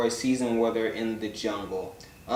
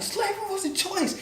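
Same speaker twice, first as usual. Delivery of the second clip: high, squeaky mock [name] voice; imitating [name]; 3 dB quieter than usual. Vlogger lampooning [name] at dinner, high mock voice. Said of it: "he'd be like".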